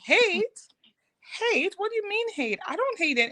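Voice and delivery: high, startled repeating of hate; shocked disbelief. Retold or imitated. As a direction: caught off guard by a child's question. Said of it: "And I was like".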